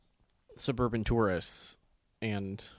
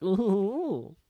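high, pleased little ooh; a satisfied high ooh; delighted ooh; 9 semitones higher than usual. Retold or imitated. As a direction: coo it with delight. Acted reaction quote.

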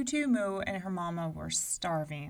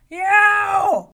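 very high, loud pained yelp; giant yelp of pain; 14 semitones higher, 18 dB louder than usual. A narrator's reading. Giant stabbed with a hairpin, loud yelp. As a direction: yelp as if jabbed with a pin. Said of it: "shouted the giant".

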